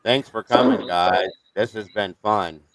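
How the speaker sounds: low, slow, lifeless monotone drone; monotone impression. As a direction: drone it out like a boring streamer with no personality. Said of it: "that's how he sounds".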